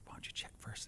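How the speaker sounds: hushed whisper. Retold or imitated. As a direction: whisper it warily. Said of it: "Why are we whispering?".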